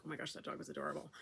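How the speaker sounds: fast, hushed gush; quick whisper. Retold or imitated. Couple gushing over a passing dog, fast whisper. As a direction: whisper it quickly to your partner. Saying it to each other.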